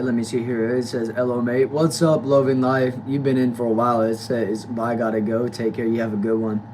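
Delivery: ordinary talk, nothing marked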